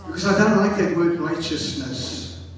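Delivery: ordinary delivery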